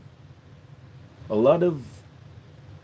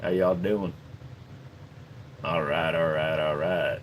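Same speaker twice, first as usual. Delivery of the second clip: a Southern country accent, dropped low; 12 semitones lower, 7 dB quieter than usual. Shown as a demonstration. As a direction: greet them like a Texas cowboy. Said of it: "You want me to go country?".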